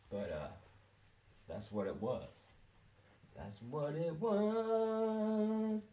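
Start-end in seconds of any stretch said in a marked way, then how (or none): 3.4-5.8 much higher, very slow was; drawn-out high repeat. Shown as a demonstration. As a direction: stretch the was out long and high.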